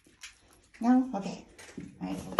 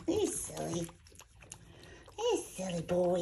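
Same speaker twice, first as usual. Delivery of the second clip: ordinary talk, nothing marked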